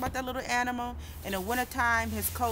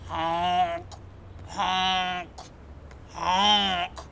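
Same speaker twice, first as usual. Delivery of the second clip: goose honk; 3 semitones lower, much slower than usual. Acted. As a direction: honk like a goose flying south. Geese call in story.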